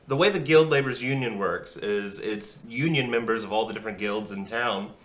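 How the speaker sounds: ordinary delivery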